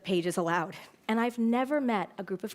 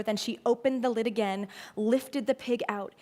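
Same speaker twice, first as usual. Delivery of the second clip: usual speaking voice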